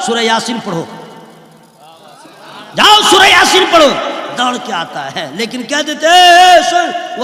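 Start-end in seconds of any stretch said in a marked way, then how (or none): 2.8-3.9 high, loud mock command; sudden acted shout; shouted order. Acted teacher ordering the boy off. 6.1-7.2 high, loud drawn-out hail; mock call-back yell. Acted teacher calling boy back.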